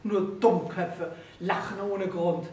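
slow, cold reproach; scolding. A narrator's reading. Husband scolding his wife, slow and cold. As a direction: lay each word down with contempt. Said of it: "fuhr Pawel Iwanitsch seine Frau an".

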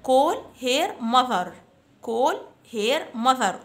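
put-on very slow, clear drill phrase for children to repeat; slow model phrase. Shown as a demonstration. Teacher to fifth graders, model phrase very slowly. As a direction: stretch each word out for the class. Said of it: "Repeat after me".

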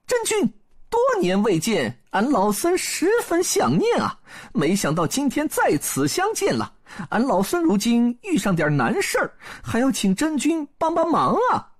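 put-on high-pitched Monkey King greeting an old acquaintance; 孙悟空 courteous. A narrator's reading. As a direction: ask a favour politely in a high monkey-hero voice. (孙悟空高嗓门，恭敬问候)

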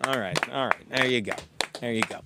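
low, soft, modest acknowledgement; mock humble thanks. To applauding co-hosts, soft low bashful thanks. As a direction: wave off the applause softly and low.

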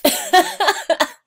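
high, loud laugh. Real, not acted. Vlogger laughing at herself before signing off. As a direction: burst out laughing. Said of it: "I'm laughing at myself".

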